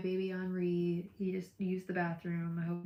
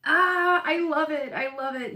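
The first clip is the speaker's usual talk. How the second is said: much higher and louder, delighted; thrilled squeal; 10 semitones higher, 13 dB louder than usual. Real, not acted. Thrilled for the viewer's ultrasound, squealing loudly. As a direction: burst out with joy.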